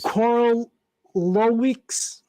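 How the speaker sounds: Korolowicz sounded out slowly, syllable by syllable; careful slow attempt. Real, not acted. Trying the artist's surname on a podcast, slowly. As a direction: drag out Korolowicz carefully, unsure of each sound. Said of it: "I'm gonna butcher this".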